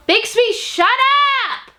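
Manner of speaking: sharp high yell at the pet; shouting at pet. Real, not acted. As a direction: snap loudly at the noisy animal. Scolding the pet mid-video.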